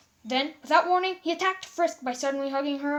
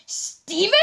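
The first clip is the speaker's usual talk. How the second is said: hopeful surprise; 6 semitones higher, 6 dB louder than usual. Acted. Amethyst recognizing lost friend.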